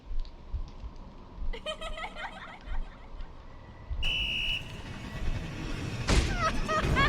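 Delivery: high-pitched burst of laughter. Real, not acted. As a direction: laugh it off, amused and exasperated. Janitor ruined moment.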